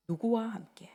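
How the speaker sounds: whispered question. Acted. Pastor to the congregation, whispering the question. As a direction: lean in and breathe the question.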